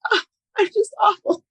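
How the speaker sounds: high whiny moan of a mock-sad wife; hammed-up pitiful wife voice, pitched high; mock self-pity. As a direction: whimper it like you're fishing for comfort. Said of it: "Your wife's like".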